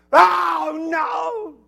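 loud, high wail of dismay; dismayed cry. Acted. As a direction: wail in hammed-up horror. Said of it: "And then another bill arrives".

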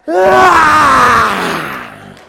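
high shouted ah, playful; mock spirit yell. Kid picturing the Spirit arriving, mock shout. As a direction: let out a hammed-up spooky cry. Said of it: "picturing the Spirit coming, going".

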